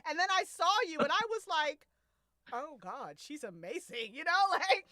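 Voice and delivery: loud, high, overwrought confession; hammed-up lovesick outburst; pining rant. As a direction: gush it out, voice climbing. Acting out the character's pining rant.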